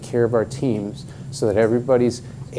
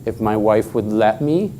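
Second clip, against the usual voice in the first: ordinary talk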